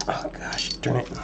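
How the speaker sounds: breathy whispered frustration; whispered curse. Real, not acted. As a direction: curse under your breath. Muttering at a stubborn part.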